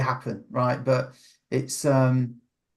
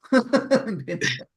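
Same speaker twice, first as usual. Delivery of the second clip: laugh; 9 semitones higher than usual. Real, not acted. Laughs at own joke.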